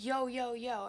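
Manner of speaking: loud retold yo, yo, yo to the stranger; calling out. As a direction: call out yo, yo, yo across the driveway. Confronting stranger at garage.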